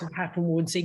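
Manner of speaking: ordinary delivery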